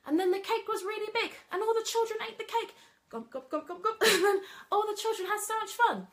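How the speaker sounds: put-on high and loud, bouncy kids' story voice; excited storytelling voice. Shown as a demonstration. Nursery worker demoing cake make-believe for kids, high and loud. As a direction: build the cake up like magic for toddlers. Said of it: "at the end I'll say".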